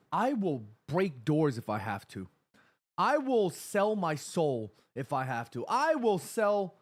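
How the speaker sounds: slow determined vow. Real, not acted. Vowing to get final tickets.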